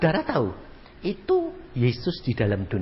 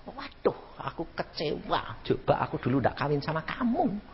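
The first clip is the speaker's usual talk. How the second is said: soft, let-down wife's lament; softly voiced regret of a wife; disappointed wife; 4 semitones higher, 9 dB quieter than usual.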